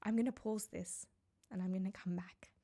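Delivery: a tearful, breathy whisper; real whispered aside while welling up; choked-up whisper. Real, not acted. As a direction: whisper it, barely holding back tears. Pausing recording, overcome with emotion.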